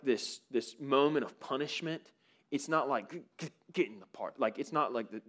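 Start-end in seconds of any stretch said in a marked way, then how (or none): 3.1-4.4 much softer, quick stuttered words through tight teeth; mock grudging order with the teeth barely moving; through clenched teeth. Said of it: "you talk but your teeth don't move".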